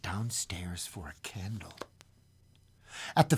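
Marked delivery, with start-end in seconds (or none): 0.0-1.7 whisper. A narrator's reading. Tense narration drops to whisper.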